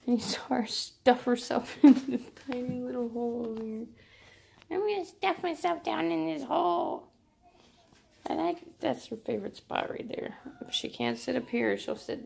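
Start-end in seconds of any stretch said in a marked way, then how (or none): 4.7-7.1 cat voice. Retold or imitated. Voicing the cat.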